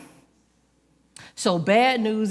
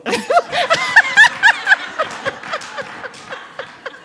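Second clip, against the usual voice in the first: high, loud squealing laugh; big real laughter; burst of laughter; 6 dB louder than usual. Real, not acted. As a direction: crack up at your own joke. Bursts out laughing at own joke.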